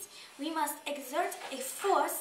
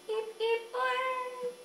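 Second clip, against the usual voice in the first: rising celebratory cheer, higher; hooray cheer; 9 semitones higher, slower than usual. Acted. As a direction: cheer it out, hands up. Celebrating finished measuring.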